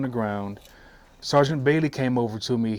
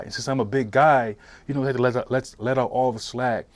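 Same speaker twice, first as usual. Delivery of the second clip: usual speaking voice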